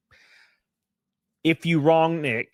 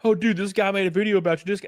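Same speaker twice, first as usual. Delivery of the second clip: fast, higher-pitched mock alarm; imitating Discord followers; 6 semitones higher, faster than usual. Follower warning streamer about a video, mock urgent. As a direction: rush it like a tattling follower. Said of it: "People on Discord would send me".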